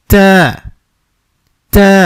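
তা said firm with a breath release; strong তা with audible air. Shown as a demonstration. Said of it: "হরফটি শক্তও থাকবে". (বাতাস সহ শক্ত তা)

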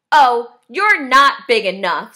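loud, dismissive bug; lightly voiced blustering grouch; grouchy ladybug. A narrator's reading. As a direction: brush off the rival loudly. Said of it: "said the grouchy ladybug".

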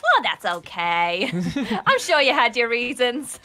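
soothing like an unbothered mum; mum Linda. Retold or imitated. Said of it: "She'd be like".

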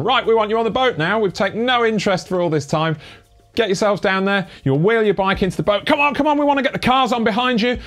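high, urgent staff voice hustling cyclists; mock bossy crew member rushing you aboard; hurried ferry staff. Impersonating hurrying ferry staff.

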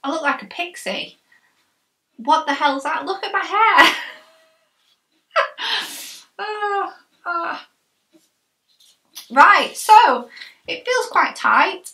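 speaking with jaw strapped; chin strap on. Real, not acted. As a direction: through tight chin band.

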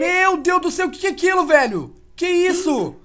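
shocked shout. Real, not acted. Shocked by shark alien.

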